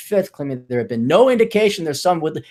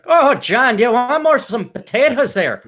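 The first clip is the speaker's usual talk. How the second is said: high, hammed-up Irish brogue; exaggerated Irish mom accent, pitched up; 8 semitones higher, 4 dB louder than usual. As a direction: do an Irish mother pushing food. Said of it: "do it with an Irish brogue".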